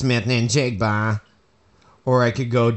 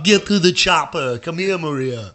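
action hero impression; 2 semitones higher than usual. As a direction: bark it like an action star. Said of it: "How about I talk in this voice instead".

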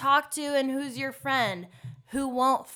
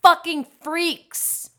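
emphatic; 7 semitones higher, 8 dB louder than usual. Real, not acted. Emphatic declaration.

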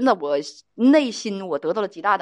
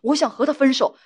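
ordinary delivery

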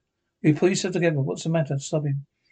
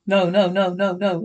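frightened cries; 3 semitones higher, 4 dB louder than usual. Acted. Waking from a bad dream.

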